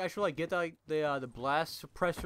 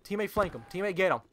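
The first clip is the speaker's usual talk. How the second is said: ordinary talk, nothing marked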